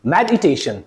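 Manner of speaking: मेडिटेशन as med-i-TAY-shun, low; correct syllable break. Shown as a demonstration. Teacher modelling for students, मेडिटेशन as med-i-tay-shun. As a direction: keep med together in मेडिटेशन, light separate i. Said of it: "ई को अलग बोलोगे".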